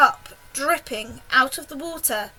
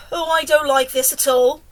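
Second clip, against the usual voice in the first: loud, mildly hammed-up wail; wailing earthworm; 6 dB louder than usual. A narrator's reading. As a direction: complain in a put-on creature's wail. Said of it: "wailed the earthworm".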